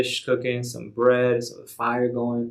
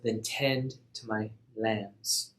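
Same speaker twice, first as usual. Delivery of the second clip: slow, soft and tender; gentle charge; 7 dB quieter, much slower than usual. Acted. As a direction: lay the charge on him softly. Jesus gives Peter his charge.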